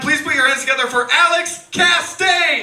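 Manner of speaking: loud, high emcee hype-up; announcer intro. Acted. Host hyping the crowd for the comic, announcer style. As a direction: sell the next act to the room.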